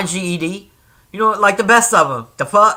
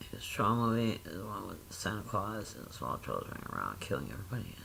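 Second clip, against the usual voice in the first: very low, soft near-whisper; under the breath; 12 semitones lower, 18 dB quieter than usual. Real, not acted. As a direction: mumble it to yourself while reading. Reading movie details under breath.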